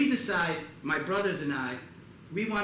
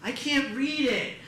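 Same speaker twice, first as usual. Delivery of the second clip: high, slow mock whine; louder, higher imitated complaint; complaining kids; 9 semitones higher, 5 dB louder, slower than usual. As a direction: drag it out like griping kids. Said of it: "nieces and nephews are like".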